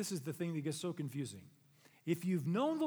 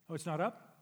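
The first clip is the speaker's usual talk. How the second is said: usual speaking voice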